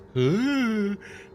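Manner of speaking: high, drawn-out "hoo" noise; imitated whoosh. Podcaster imitating walkers suddenly showing up, high whooshing noise. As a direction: mimic a sudden swarm with a hoot.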